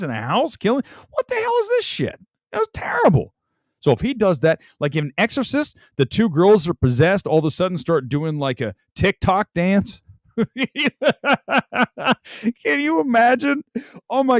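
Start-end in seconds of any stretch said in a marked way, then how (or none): 1.1-3.3 high, squeaky outrage; sky-high indignant squeal; outraged disbelief. Real, not acted. Outraged rant at the film. 10.4-14.4 high cackle into a disbelieving question; high laughing disbelief; incredulous laugh. Real, not acted. Laughing at absurd idea.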